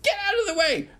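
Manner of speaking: high, loud eager demand; bold girl. Acted. Brave girl demanding the roundabout, high loud shout. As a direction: shove forward high and loud. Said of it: "she'd go".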